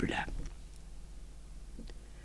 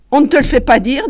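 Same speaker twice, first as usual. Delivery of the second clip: higher, louder sarcastic retort; mocking woman; 8 semitones higher, 10 dB louder than usual. A narrator's reading. Chantal teasing Paul, high loud jeer. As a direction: jeer back at him.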